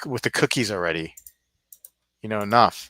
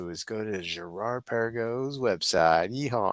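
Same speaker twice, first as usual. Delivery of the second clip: put-on Southern accent. Vlogger sending viewers to a website, in a put-on Southern accent. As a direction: put on a playful Southern twang. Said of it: "I'm doing a Southern accent".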